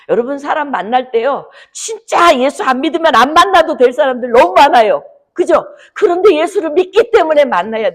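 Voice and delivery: high, loud and urgent pulpit cry; fiery preaching. Acted. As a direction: drive the challenge home to the congregation.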